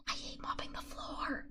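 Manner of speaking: hushed, unvoiced praise; whisper. Real, not acted. Streamer whispering praise for mopping. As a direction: whisper it close and soft.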